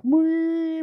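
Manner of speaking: very high, loud ringing "wee"; high shining "wee". Acted. Storyteller imitating the Holy Grail appearing, high ringing "wee". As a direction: squeal a magical shimmer.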